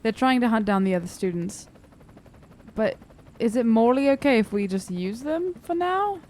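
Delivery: normal talking voice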